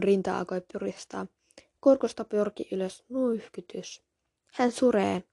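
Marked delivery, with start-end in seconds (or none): none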